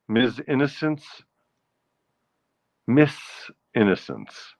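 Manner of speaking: ordinary talk, nothing marked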